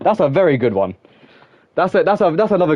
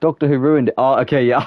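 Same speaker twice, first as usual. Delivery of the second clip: normal talking voice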